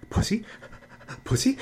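very high, eager puppy-like yelps; very high, squeaky excited mimicry; squeaky mock friends. Mimicking friends reacting like puppies.

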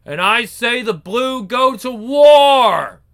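slow, commanding war cry; rallying shout. Acted. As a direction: rouse the army to war. Leader rallies people to war.

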